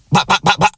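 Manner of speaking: high-pitched mock gun ad-libs; rapid-fire high "bah" bursts; rap ad-lib imitation. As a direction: mimic a rapper's staccato ad-libs up high. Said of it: "he was, like, doing the ad libs".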